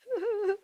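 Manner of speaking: high mock sobbing; put-on boo-hoo crying noise; Delilah. Delilah crying with Samson in her lap, hammed-up sobs. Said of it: "she's crying. She's going".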